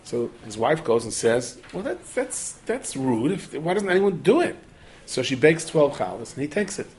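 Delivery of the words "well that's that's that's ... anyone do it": indignant objection. Acted. Acted wife objects indignantly.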